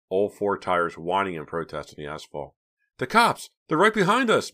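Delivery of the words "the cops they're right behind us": very high, loud alarm; panicked shout. A narrator's reading. Mickey warns of police behind them, panicked shout. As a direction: shout a warning as high as a siren. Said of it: "Mickey shouted".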